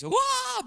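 high, loud mock shriek of a worshipping lady; mock church-lady wail. Kid mocking church ladies, loud high mock shriek. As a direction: squeal it out like the craziest lady in church. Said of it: "make fun of all the manifestations".

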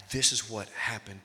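normal talking voice

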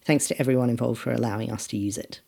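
usual speaking voice

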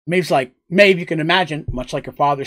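ordinary talk

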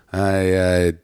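slow Ottawa Valley accent. Shown as a demonstration. Comic demonstrating home dialect to the host, Ottawa Valley accent. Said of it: "that's a very Ottawa accent".

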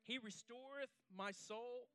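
slow, hushed reading of the psalm; solemn scripture reading. Acted. Pastor reading Psalm twenty-three to the congregation, slow and solemn. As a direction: let each phrase settle slowly.

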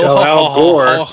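slow, drawn-out mock gruff voice; mocking gruff guy. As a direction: drawl it like a smug gym guy. Adds to the impression.

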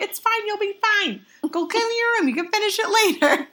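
put-on calm the kid down like a busy mom; brushing-off mom. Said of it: "she's like".

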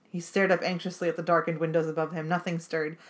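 ordinary delivery

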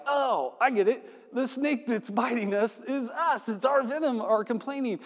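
high voice of a sudden realization; like waking up to the truth; dawning realization. Retold or imitated. Israelites voiced realising something.

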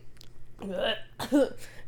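sudden cough on spit; choking cough. Real, not acted. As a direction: choke and cough. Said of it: "I just choked on my spit".

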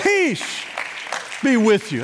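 high-pitched PEACE, then settling; ringing, spaced-out proclamation; proclaimed blessing. Retold or imitated. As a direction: call the blessing out over the congregation. Preacher proclaims Jesus' greeting.

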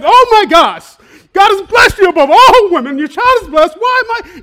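high, shouted burst of joy; exuberant cry. Acted. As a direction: cry it out, overflowing with delight. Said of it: "There was, like, this exuberance, this joy. She cried out".

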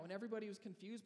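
normal talking voice

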